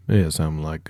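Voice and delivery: normal talking voice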